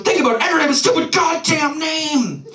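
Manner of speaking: high-pitched exasperated mock rant; angrier outburst. Brother insisting on his point to baffled sibling, angrier. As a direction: imitate a brother erupting in frustration. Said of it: "getting visibly angrier and angrier".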